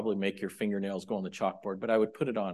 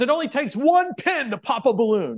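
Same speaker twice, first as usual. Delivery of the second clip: emphatic quote; 12 semitones higher, 7 dB louder than usual. Retold or imitated. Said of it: "He says".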